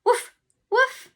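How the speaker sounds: high, loud playful woofs; dog barking. Shown as a demonstration. Teacher showing kids what a dog says, loud woofs. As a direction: bark like a little dog. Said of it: "Can you do it".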